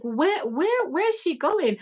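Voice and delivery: a bewildered parent asking aloud; lightly puzzled question about the child leaving; puzzled where. Retold or imitated. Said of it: "And you're like".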